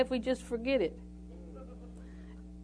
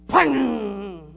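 outburst sound; 2 semitones lower, 5 dB louder than usual. Retold or imitated. Imitates an angry outburst.

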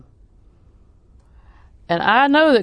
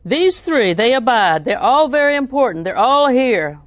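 loud preaching build; 4 semitones higher, 7 dB louder than usual. Acted. Preacher proclaiming loudly to the church. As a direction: proclaim it to the congregation.

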